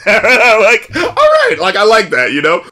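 usual speaking voice

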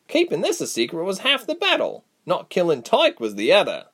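a sharper voice, irritated but caring; stern Reshma. A narrator's reading. Reshma's dialogue.

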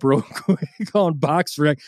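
ordinary talk

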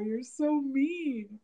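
half-laughing retold reproach; mock tearful complaint. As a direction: imitate being teased to tears. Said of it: "I'm not crying".

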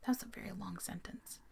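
quick, barely voiced whisper; whispered aside. Real, not acted. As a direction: slip it in under your breath. Aside while reading a quote.

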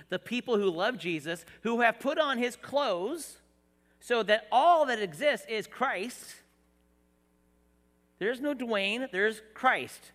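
loud, rising preacher's call; preaching at full force. Acted. Pastor preaching to his church about unity, at full force.

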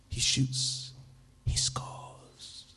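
hushed football commentator's whisper; whispered commentary. A narrator's reading. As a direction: whisper it like a goal call into a friend's ear. Bear hug, mock commentary in ear.

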